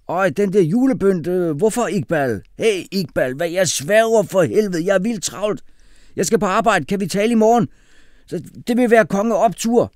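high, loud, hurried excuse; Kasim, street-slang brother. A narrator's reading. Kasim brushing the boys off before rushing out, loud street talk. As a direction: dodge the question loudly in a high voice.

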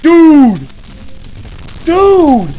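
loud, high protest; exasperated shout. Real, not acted. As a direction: shout it high over the din. Reacting to loud game sound.

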